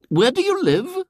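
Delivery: very high lady's voice; a purposeful lady asking; inquiring lady. A narrator's reading. Lady follows William off the coach, inquiring and high.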